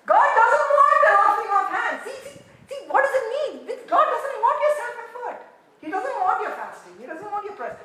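high, laughing, insistent; laughing preaching. Real, not acted. Preacher laughing at self-effort to the church, high and excited. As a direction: ride the laugh as you press the point.

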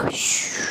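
soft breathy snore hiss; shushing snore sound. Acted. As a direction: hiss like a sleeping tadpole's snore. Snoring sound in story.

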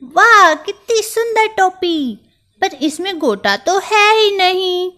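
high, loud delight that turns to complaint; a tiny mouse thrilled with his reflection; admiring little mouse. Acted. Mouse admires cap in mirror.

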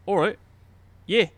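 quick Aussie "All right, yeah"; Aussie accent. Retold or imitated. Retelling his friend's Aussie chat, in a mock Aussie accent. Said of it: "in an Aussie accent were".

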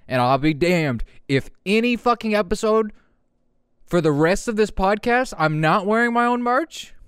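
excited rant. Real, not acted. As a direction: declare it with hype. Passionate merch rant.

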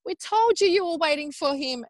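high-pitched imitation of a knowing midwife; retold told-you-so. Midwife telling labouring mother she was waiting, knowing.

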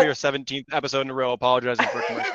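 rushed and mumbled, x1.8; fast. Pretend. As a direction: rattle it off quickly. Rushed mock apology.

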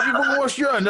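high, laughing mock telling-off; mock outraged scolding. Telling off his mate's mum about washing hands, high mock outrage. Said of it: "I said".

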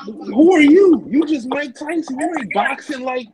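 a challenge thrown in the face of a big name; indignant callout. Retold or imitated. Telling Mike Tyson off for jumping the line, high indignant challenge.

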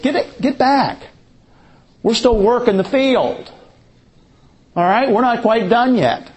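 high, calling out to gleaners; field boss warning. Retold or imitated. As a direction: shoo them back like a foreman. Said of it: "they would be told".

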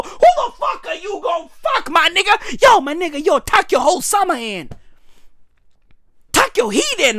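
ordinary delivery

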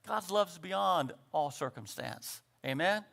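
ordinary talk, nothing marked

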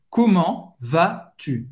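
"Comment vas-tu?" muito devagar; slow model. Shown as a demonstration. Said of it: "seria comment vas-tu". ("Comment vas-tu?" devagar)

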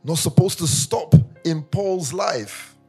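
normal talking voice

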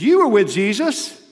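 high, loud accusation; accusing bystander. Acted. A bystander at the fire accusing Peter, loud and high. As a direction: point the finger at Peter, loud and sure.

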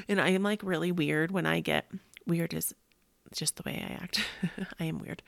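ordinary delivery

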